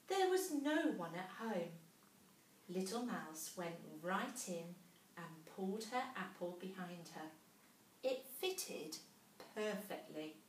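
ordinary delivery, nothing marked

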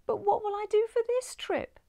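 high, tearful wail of a question; weeping. Acted. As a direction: cry out in despair. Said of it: "She wept".

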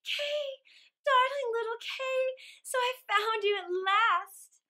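high, loud overjoyed cry; crying out joyfully. Acted. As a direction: call out to him in relief and joy. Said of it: "and cried".

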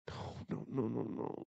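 distressed murmur. Acted. Hears her crying.

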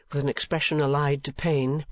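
plain reading voice

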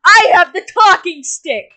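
loud, high, bossy cut-off; indignant shout. Real, not acted. Shouting over his friend to keep telling the story. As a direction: shout him down, he's hogging the story.

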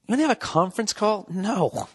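high, fast sneering question; mock caller asking for a call. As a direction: needle the caller with it. Mocking a caller.

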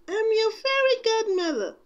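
high, kindly godmother voice; a kind old fairy godmother greeting; kindly old lady. A narrator's reading. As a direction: gently introduce yourself as the fairy godmother. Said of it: "the lady said kindly".

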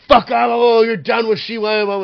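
high, loud furious yell; angry shout. Retold or imitated. Braun Strowman yelling to the bartender, angry shout. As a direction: order him thrown out at full volume.

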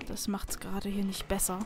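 whispered aside while hiding; hushed, nervous whisper. Real, not acted. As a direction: whisper so nobody hears. Sneaking past enemies.

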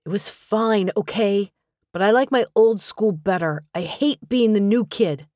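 pushing back at Dad, grumpy; sulky boy. Acted. Son complaining to his father about the new school, sulky. Narrator voices sulky Spencer.

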